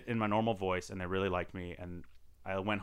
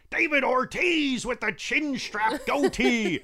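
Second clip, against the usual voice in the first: high, drawn-out play-by-play caricature; sportscaster character voice; 12 semitones higher, 4 dB louder, slower than usual. Retold or imitated. Auditioning among a thousand people, goofy sportscaster voice. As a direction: stretch every call like an over-the-top baseball announcer. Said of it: "I'm just going in that character".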